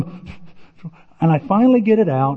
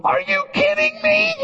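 exasperated disbelief. Retold or imitated. Tim staring at his sliced-open hand, high exasperated squeal. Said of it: "I go".

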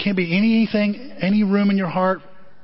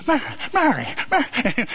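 higher, urgent calling out; calling Mary; 4 semitones higher than usual. Retold or imitated. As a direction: George chases his wife calling, urgent. George calling after Mary.

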